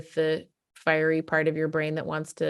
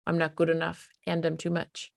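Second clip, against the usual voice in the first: usual speaking voice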